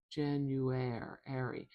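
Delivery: stretched out syllable by syllable; slowly sounding out January. Shown as a demonstration. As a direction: drag the word out slowly to show each sound. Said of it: "Janu, you".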